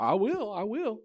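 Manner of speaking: high, loud acted protest; mock bluster. Dealer answers Wyatt Earp's dare, blustering. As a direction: insist like a bully called on his bluff.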